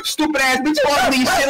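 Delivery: ordinary talk, nothing marked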